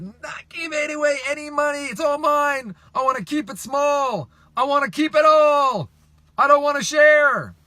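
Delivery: high, loud mock tantrum; greedy hoarder. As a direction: wail it like a child refusing to share. Acting out greedy profit hoarder.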